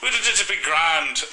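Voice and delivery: high, drawn-out attempt at a British accent; exaggerated British accent. Shown as a demonstration. Showing co-host his British accent, slow and hammed-up. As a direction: ham up a bad British accent. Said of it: "I'm pretty sure I had a British accent".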